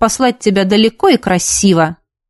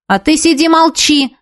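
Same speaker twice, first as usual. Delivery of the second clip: high but restrained narrated yell; 6 semitones higher than usual. A narrator's reading. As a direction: snap back with raised pitch, not full volume. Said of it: "закричала Нина". (сдержанный крик, на повышенных тонах)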